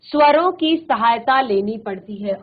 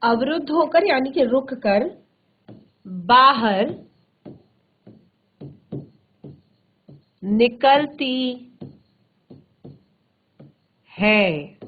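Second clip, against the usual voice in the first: dictation pace; slower than usual. Shown as a demonstration. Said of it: "slow dictation of the definition".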